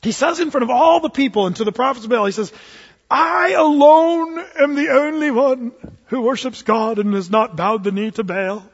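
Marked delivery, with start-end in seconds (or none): 3.1-8.6 grand theatrical British declamation, higher and louder; British accent. Acted. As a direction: declaim slowly like a British stage actor. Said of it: "gave him a British accent".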